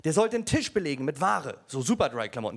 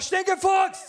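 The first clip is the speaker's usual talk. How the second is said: shouted punchline; 10 semitones higher, 4 dB louder, much slower than usual. Retold or imitated. Punchline call.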